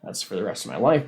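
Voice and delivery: ordinary delivery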